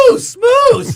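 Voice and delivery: high shout of alarm; yelling. Retold or imitated. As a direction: yell it out, pointing. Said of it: "And I'm yelling".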